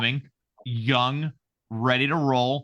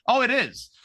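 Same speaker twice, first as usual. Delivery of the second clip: high, knowing sarcasm; high pitched mock answer; sarcastic aside; 9 semitones higher than usual. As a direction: drop it like an obvious wink. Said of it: "sarcastic voiced answer".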